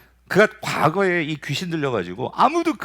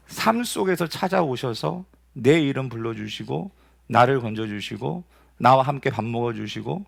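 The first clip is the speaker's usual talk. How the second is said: usual speaking voice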